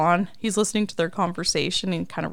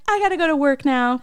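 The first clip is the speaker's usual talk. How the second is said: high, louder peppy sign-off; perky mock farewell; 8 semitones higher, 5 dB louder than usual. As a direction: imitate an over-chirpy woman leaving. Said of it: "so Mickey Mouse Club right now".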